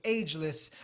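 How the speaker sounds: ordinary talk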